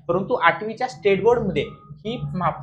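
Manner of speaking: ordinary talk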